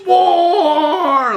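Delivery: high, loud, drawn-out mock battle cry; imitated war yell. Prince yelling with all the troops, imitated war cry. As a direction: belt it out like a hyped-up commander. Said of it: "yelling with all the troops".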